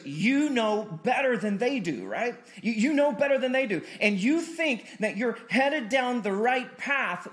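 preacher calling out the stubborn listener; rising preaching. Acted. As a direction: drive the point home to the congregation. Preacher building to a peak.

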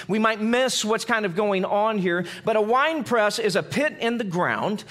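ordinary talk, nothing marked

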